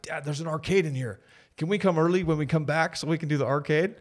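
high, loud, imitating a thrilled kid; excited daughter. Daughter begging Dad to visit the airport arcade, excited. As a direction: plead eagerly like an excited child. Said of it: "my daughter was so excited".

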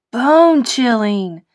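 loud, thrilled cry; a skeleton classmate shouting praise. Acted. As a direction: call it out in awe. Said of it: "shouted Skelly".

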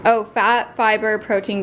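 ordinary talk

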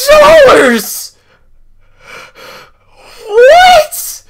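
high, crying screams of disbelief; overwhelmed, tearful shouting; screaming with joy. Real, not acted. Learns item worth two thousand.

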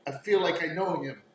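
high, halting confession; pleading young woman. Acted. Game master voices Irina resisting.

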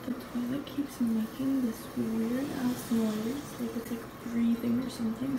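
hushed whisper to the camera. Real, not acted. Vlogger sneaking a washroom tour to viewers, whispering. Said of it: "I'm whispering because there's nobody in here".

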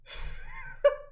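squealing pained laugh; high-pitched laugh. Real, not acted. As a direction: laugh high through the burn. Laughing after eating wasabi.

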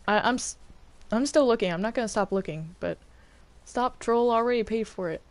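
ordinary talk, nothing marked

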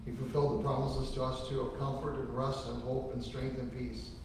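normal talking voice

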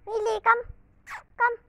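high, loud call to the dog; high shouted call. Real, not acted. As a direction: call her back. Calling dog.

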